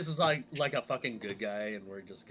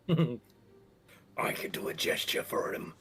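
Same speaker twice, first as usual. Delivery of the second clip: laughing, breathy and slow; whispery laugh; 5 semitones higher, 3 dB louder, slower than usual. Real, not acted. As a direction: giggle it out under the breath.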